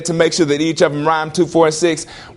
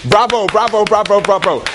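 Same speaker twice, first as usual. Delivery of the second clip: higher, self-congratulating bravos; rapid-fire mock cheering; mock applause; 5 semitones higher than usual. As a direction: cheer your own poem like an adoring audience. Cheers own made-up poem.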